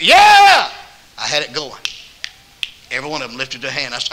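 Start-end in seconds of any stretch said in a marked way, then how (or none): 0.0-0.7 high, loud, eager "Yeah!"; excited yell. Retold or imitated. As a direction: shout it like an eager trucker. Truck driver's eager reply.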